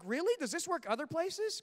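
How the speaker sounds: high, curious questioning; intrigued surprise. Retold or imitated. Husband wondering if praise works elsewhere, intrigued. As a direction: perk up high with a new idea. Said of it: "I was like".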